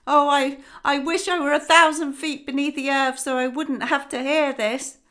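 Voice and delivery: loud, high wail of dread; terrified cry. Acted. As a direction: cry out as if you can't bear to hear it. Terrified mother cries out.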